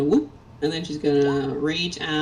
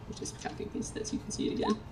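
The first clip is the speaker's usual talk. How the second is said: whisper; 10 dB quieter, much faster than usual. Real, not acted. Aside while pasting.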